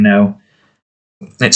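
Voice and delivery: usual speaking voice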